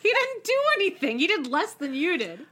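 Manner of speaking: high, laughing disbelief; laughing outrage. Retold or imitated. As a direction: cackle out the protest.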